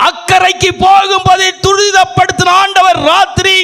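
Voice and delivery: high, loud preaching shout, slow; fervent shouted sermon; preaching at full force. Acted. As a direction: proclaim it, crying out.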